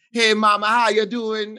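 high, loud sweet-talking hello; code-switched greeting. Retold or imitated. Brooklyn lady switching to a sweet hello, high and loud. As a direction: chirp it like a friendly neighbor. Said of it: "she'd be like".

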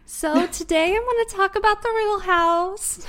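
high, loud, chirpy service voice; customer service voice. Pretend. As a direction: sound like a cheerful phone rep. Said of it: "Oh my fucking God".

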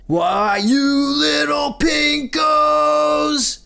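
high, drawn-out sergeant scream; outraged yell. A narrator's reading. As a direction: stretch it into a furious rising yell. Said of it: "Sergeant Leakey yells".

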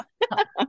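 a short high burst of laughter; bright laugh. Real, not acted. Guest laughs after her quote.

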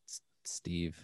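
hushed ghost name; whisper. Pretend. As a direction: whisper it like a secret. Jokingly naming the ghost.

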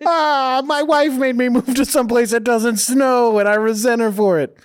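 a New Jersey guy griping about his wife; grumbling fake New Jersey accent; mock Jersey accent. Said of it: "I was Kevin Smith".

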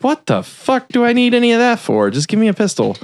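high-pitched, exasperated retelling; mock incredulity. Gangster turning down the gun dealer's fancy weapons, mock incredulous. Retold character rejects fancy guns.

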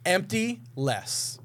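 each word spelled out slowly; slow, deliberate clue. Shown as a demonstration. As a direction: read the clue slowly and clearly. Reads out a puzzle clue.

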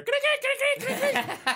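very high squeaky chirps, laughing; cricket chirp imitation.

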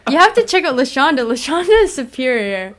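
fast, loud, high hype; excited hype. Real, not acted. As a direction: sell the surfboard like an eager promoter. Hyping friend's surfboard.

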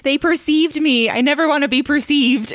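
hammed-up dismay, pitched high; mock distress. [name] bemoans strangers noticing her, mock distress.

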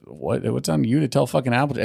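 normal talking voice

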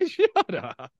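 high, laughing brush-off; amused telling-off through laughter; laughing protest. Real, not acted. Laughing at co-host's dismissal.

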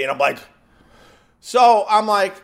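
usual speaking voice

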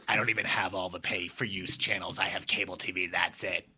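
put-on low voice of an emailer; Harvey Fierstein. Host resumes reading listener's email, low character voice. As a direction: drop low and lay down the complaint. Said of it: "she kinda has that voice".

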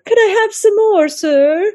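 high, mock begging child-like plea; meek pleading. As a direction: beg very high, hammed-up and eager to please.

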